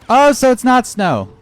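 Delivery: high loud mock surprise; surprised. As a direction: act out sudden realisation. Acting a surprised character.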